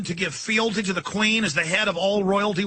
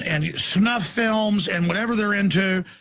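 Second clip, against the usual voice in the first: ordinary talk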